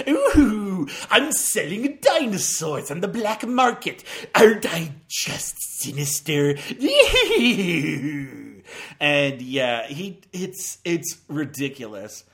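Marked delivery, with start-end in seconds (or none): 0.1-6.6 gleeful hammy villain, laughing; mustache twirling villain. Retold or imitated. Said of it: "turned into a mustache-twirling villain". 6.6-8.9 hearty amused laugh. Real, not acted. Laughs at own villain impression.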